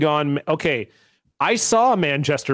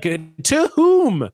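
incredulous; 9 semitones higher, 3 dB louder than usual. Retold or imitated. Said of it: "I'm like".

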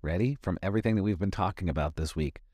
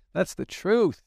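loud high declaration; 12 semitones higher, 5 dB louder, slower than usual. Shown as a demonstration. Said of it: "repeated line".

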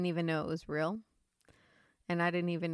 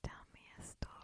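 whisper; 17 dB quieter, much faster than usual. Pretend. Asks for a spooky story.

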